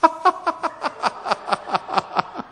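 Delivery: long, high staccato cackle; exaggerated demonstration laugh; forced hearty laugh. Shown as a demonstration. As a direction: force out a stream of laughter, falling in pitch. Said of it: "무조건 웃어야 돼요".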